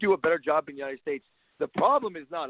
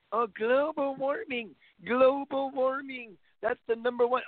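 higher, slow, drawn-out mock whine; mocking whiny liberal; 6 semitones higher, slower than usual. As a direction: stretch out global warming like a complaining sissy. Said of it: "the constant whining from the liberals".

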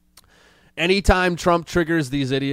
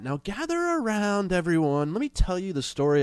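fireside chat voice; 5 semitones higher, 4 dB quieter than usual. Retold or imitated. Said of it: "making this like a fireside chat".

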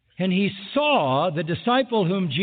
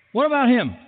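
indignant question; 7 semitones higher, 4 dB louder than usual. Retold or imitated. Peter asks about John.

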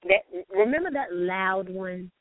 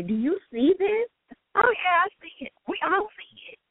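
lightly voiced gossiping coworkers, higher; imitating coworkers; 7 semitones higher than usual. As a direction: play the coworkers, knowing and amused. Coworkers' messages acted out.

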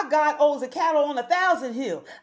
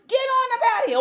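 rebuking Satan; 8 semitones higher, much faster than usual. Acted. Preacher rebuking Satan, higher.

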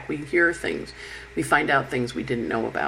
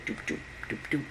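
soft rapid doop-doop sound; tiny quick-fire blips, very quiet; quick firing noises; 12 dB quieter than usual. Acted. Imitating four planets firing quickly, soft rapid doops. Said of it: "Four planets firing quickly".

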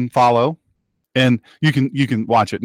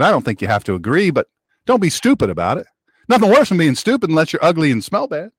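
usual speaking voice

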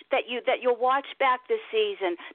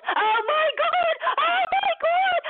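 much higher, slow, ecstatic mock squeal; a fan replaying her own stunned cheering, much higher and slow; reenacted fan scream; slower than usual. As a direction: relive the moment you shrieked at the TV. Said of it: "fans that were screaming like it was the Super Bowl".

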